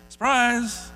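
high, loud playful "Surprise!"; spring it on them with a grin; mock surprise. Pastor teasing the congregation about being countercultural, playful shout. Joking after countercultural point.

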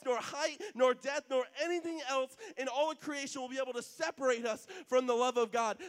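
building from read scripture to loud preaching. Acted. Preacher reading Romans to the congregation, rising to loud proclamation. Reads Romans eight aloud.

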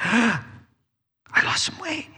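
gasp, then high whispery amazement; hushed, high-pitched acted delight; delighted surprise. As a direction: marvel breathlessly at the good news. Dieter delighted on the scale.

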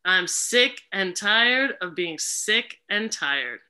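very slow, stretched words; slow drawn-out mantra. Shown as a demonstration. Facilitator echoing the helper, slow and stretched. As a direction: drag each word out. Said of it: "I'm gonna repeat after".

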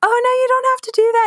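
high, quick polite refusal; flustered polite protest. Retold or imitated. Student to generous stranger at the donut shop, high flustered protest.

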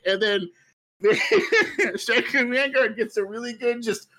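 high, squeaky talking through laughter; real giggles breaking up the words; helpless laughter. Real, not acted. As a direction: try to carry on while cracking up. Storyteller cracking up at own joke.

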